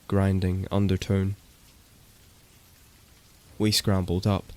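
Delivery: ordinary delivery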